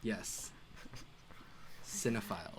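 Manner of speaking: "Cinephiles" said low and soft, drawn out; deliberate cinephiles. Shown as a demonstration. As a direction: lean into "Cinephiles" low and quiet. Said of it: "did you really have to say cinephiles".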